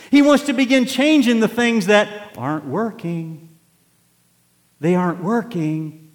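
loud, high, driving sermon voice; full-force preaching. Acted. Preacher rousing the congregation, loud full-force preaching. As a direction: preach it hard to the back pews.

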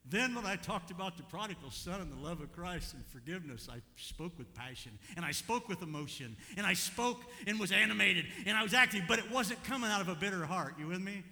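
loud, high, building passionate preaching; preaching at full force. Real, not acted. Pastor preaching loud to the congregation about passion. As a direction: preach it out with rising fire.

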